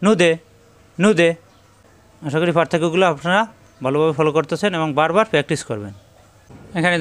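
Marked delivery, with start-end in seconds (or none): 0.0-1.3 নু দে with the দ bounced and echoing; spring the দ in নু দে out with a rebound; bouncing qalqala. Shown as a demonstration. Teacher drills students on qalqala, নু দে bounced. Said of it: "দাল সাকিন কলকলা".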